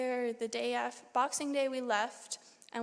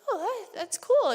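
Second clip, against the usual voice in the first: enthusiastic; 9 semitones higher, 8 dB louder than usual. Retold or imitated. Teacher's reaction to the bracelet.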